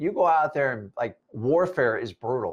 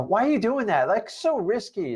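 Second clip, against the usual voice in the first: higher, whiny complainer voice; a nagging doubter, pitched up; mock complainer; 8 semitones higher than usual. Whiny doubter talking a friend out of a risky plan.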